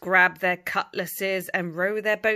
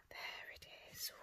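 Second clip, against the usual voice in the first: whisper; 22 dB quieter than usual. A narrator's reading. Whispered aside to children.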